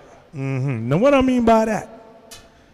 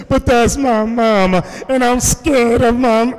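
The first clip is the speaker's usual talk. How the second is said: higher, drawn-out mock-fearful whine; mock scared simpleton; 5 semitones higher than usual. Mimicking congregants afraid of their mamas, mock scared. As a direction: imitate a grown child scared of mama. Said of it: "some of y'all get simple".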